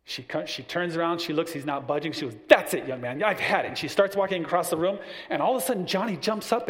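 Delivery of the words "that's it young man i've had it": she's had enough, let it rip; mom losing it. Retold or imitated. Mom loses patience with son, angry outburst. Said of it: "she was angry".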